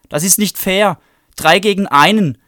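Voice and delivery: very high, loud cry on the verge of tears; tearful outcry. Acted. Bradley in tears crying out against the three girls. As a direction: wail it out as unfair. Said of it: "rief er".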